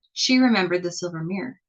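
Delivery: usual speaking voice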